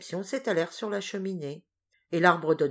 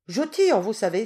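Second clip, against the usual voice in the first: much higher, much louder threat; cried out threat; 5 semitones higher, 7 dB louder than usual. A narrator's reading. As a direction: make them believe you'll shoot. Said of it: "s'écria-t-elle".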